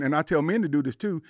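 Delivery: normal talking voice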